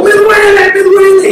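high, loud cry of pain; a man hugging a cactus shouts his pain. Retold or imitated. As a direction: yell it like someone clutching a cactus. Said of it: "gritándolo".

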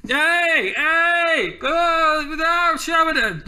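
high-pitched mock hollering; attention-getting yelling. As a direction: holler to distract a beast, without real volume. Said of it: "try and get the, uh, attention".

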